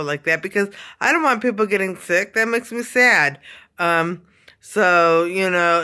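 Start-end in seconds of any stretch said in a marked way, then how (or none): none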